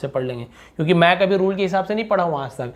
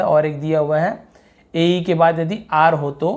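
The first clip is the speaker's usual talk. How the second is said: ordinary talk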